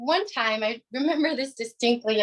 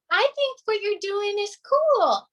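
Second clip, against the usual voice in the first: little brother voice; 9 semitones higher than usual. Retold or imitated. Brother's reply voiced in story.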